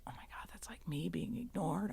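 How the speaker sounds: whispered, very soft aside to oneself; hushed private thought. Retold or imitated. Woman tearing up at the plane window, hushed private thought. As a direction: retell it in a near-silent whisper.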